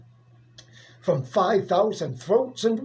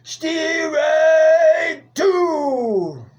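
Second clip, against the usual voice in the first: loud, higher, half-sung call; umpire's call; 6 semitones higher, 3 dB louder than usual. Acted. Umpire calling the second strike, loud higher sing-song call. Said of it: "and the umpire said".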